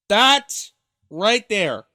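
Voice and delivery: loud, slow, pointed emphasis; emphatic. Real, not acted. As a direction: slam down each word to spotlight the quote. Stressing the clip's key line.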